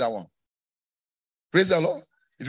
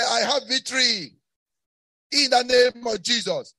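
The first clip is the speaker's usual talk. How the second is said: high, ringing proclamation; victory cry; 6 semitones higher than usual. Shown as a demonstration. Preacher gives congregation a victory line, triumphant shout. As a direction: declare it boldly for others to repeat.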